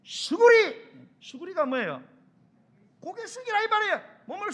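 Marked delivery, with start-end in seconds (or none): 0.0-0.9 frantic, very loud high yell; panicked shout; urgent shout. Acted. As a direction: scream a warning to duck. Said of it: "이렇게".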